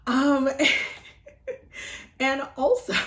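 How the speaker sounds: giggling through the words; bubbling giggles mid-sentence; laughing. Real, not acted. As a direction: laugh it out, a little embarrassed. Giggly aside while announcing.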